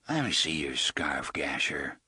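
a quiet, thick-voiced threat; soft thick voice. A narrator's reading. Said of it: "in a soft, thick voice".

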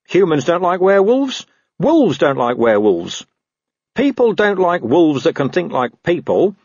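a hint of a know-it-all stray; talking dog. Gaspode lectures Carrot on werewolves, lightly voiced dog.

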